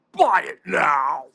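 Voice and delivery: slow shouted hard-sell tag line; ad announcer shout. Pretend. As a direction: bark the close of a cheesy TV ad. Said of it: "another sponsor today".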